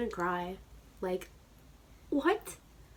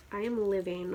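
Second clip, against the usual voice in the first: normal talking voice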